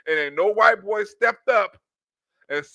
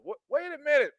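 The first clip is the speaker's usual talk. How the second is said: usual speaking voice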